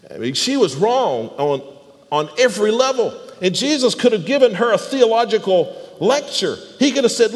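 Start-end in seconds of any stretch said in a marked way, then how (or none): none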